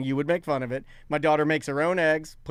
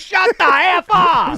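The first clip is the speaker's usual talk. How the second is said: loud, high, slow angry shout; angry fans; 10 semitones higher, 7 dB louder, slower than usual. Retold or imitated. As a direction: yell it like a fed-up fan. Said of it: "every other fan of the NBA is like".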